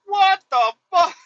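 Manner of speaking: high, loud and drawn out in shock; reliving the moment he saw the wolves; stunned disbelief. Retold or imitated. Trucker seeing wolves beside him, shocked high outburst. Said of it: "And I'm like".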